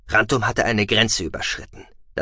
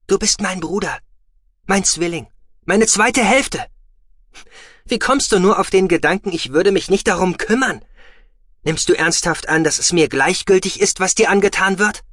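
loud, high, impassioned plea; passionate; 10 semitones higher, 5 dB louder than usual. Acted. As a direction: pour out your loyalty. Twin passionately pleading.

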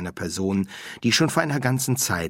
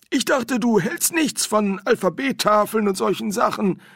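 high, louder put-on character voice; puzzled Windel; 14 semitones higher, 5 dB louder than usual. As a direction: query the medium in a high voice.

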